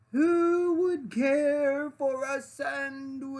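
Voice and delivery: high, slow, drawn-out far-off call; faint distant voice. Acted. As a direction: lure him in from far away, slowly. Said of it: "The voice called out".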